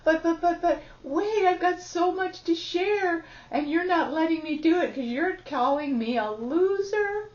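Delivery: put-on a child begging to be heard; innocent inner child pleading. Shown as a demonstration. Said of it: "But this innocence".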